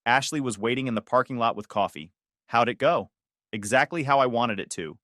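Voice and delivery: ordinary delivery, nothing marked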